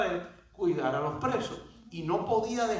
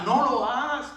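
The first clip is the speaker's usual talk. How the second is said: higher, somewhat raised imitation of a shout; mild retold shout; 5 semitones higher, 4 dB louder than usual. Preacher retells Paul stopping the jailer, mild shout. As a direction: call out a warning, half shouted. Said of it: "Pablo le grita".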